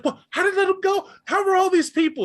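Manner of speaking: very high, frantic accusation; frantic blame in the argument; shrill high squabbling kid; panicked argument. Acted. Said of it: "he's like".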